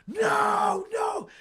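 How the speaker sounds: very high shouted plea; high frantic cries; panicked yelling. Retold or imitated. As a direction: scream at the dog to back off. Fleeing the dog Patches.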